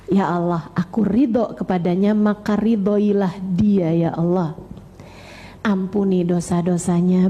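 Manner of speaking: heartfelt low prayer voice; earnest prayer. Acted. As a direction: pray humbly for your child. Continues the modeled prayer.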